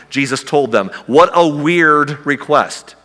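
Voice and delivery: ordinary talk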